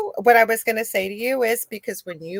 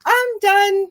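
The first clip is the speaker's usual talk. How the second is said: breezy mock quitting; 10 semitones higher than usual. Grandmother's caregiver quitting, retold.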